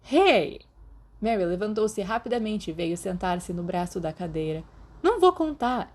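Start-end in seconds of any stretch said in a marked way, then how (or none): 0.1-0.6 sharp high hey to cut him off; startled outburst; sudden hey. Acted. Mary cuts in loudly. 5.0-5.9 high, loud earnest promise; high eager vow. Acted. Mary reassures, high pitched.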